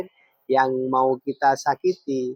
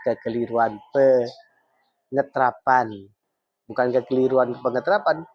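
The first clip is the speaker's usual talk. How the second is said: normal talking voice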